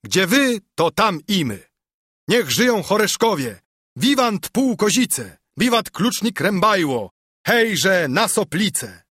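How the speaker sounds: narrated rabble shouting vivats; crowd shouting. A narrator's reading. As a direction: cheer on a rallying crowd. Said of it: "krzyknęła szlachta".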